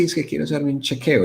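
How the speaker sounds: ordinary delivery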